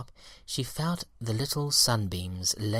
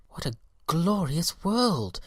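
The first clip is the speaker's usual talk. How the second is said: delighted cry; 7 semitones higher, 3 dB louder than usual. A narrator's reading. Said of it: "cried the little yellow lady".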